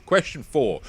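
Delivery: ordinary delivery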